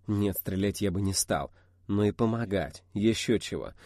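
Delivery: usual speaking voice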